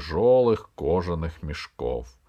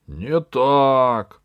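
loud, raised contradiction; interrupting shout; 5 semitones higher, 8 dB louder than usual. A narrator's reading. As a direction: shout it down as other voices in the crowd. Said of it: "перебили его другие голоса". (громкий перебивающий выкрик)

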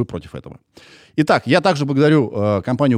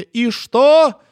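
mocking; 12 semitones higher, 5 dB louder than usual. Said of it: "сейчас такие".

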